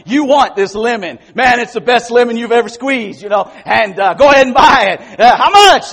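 high, shouted sales pitch; slick used car salesman. Acted. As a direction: push the sale, loud and high. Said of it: "I sound like a used car salesman".

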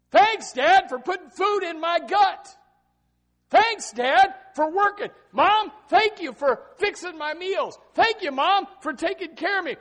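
high, loud, exuberant thank-you; shouted thanks. Acted. Preacher acting a teenager thanking his parents, loud and high. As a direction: yell the thanks like a grateful kid. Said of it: "wrote him a little note and said".

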